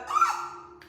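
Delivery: a sudden high startled gasp; quick surprised intake of breath; sharp gasp. Real, not acted. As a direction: gasp as if struck by a revelation. Sudden gasp mid reading.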